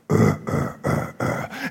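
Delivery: very low, soft pulsed grunts; imitated grunts. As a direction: grunt deep in the throat, wordlessly. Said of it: "He would grunt".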